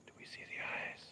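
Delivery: hushed whisper in the dark. Real, not acted. Looking for the cat at night, whispering. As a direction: breathe it so the cat isn't spooked.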